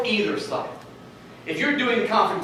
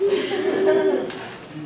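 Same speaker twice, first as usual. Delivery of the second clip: nervous laugh; 10 semitones higher than usual. Real, not acted. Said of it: "laugh at own line".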